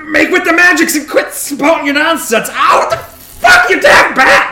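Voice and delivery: high, loud irritated bellow; cantankerous wounded roar, rising to a yelp; pained grumpy shouting. Acted. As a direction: yell back at the healer through pain. Injured half-orc snaps back at healer.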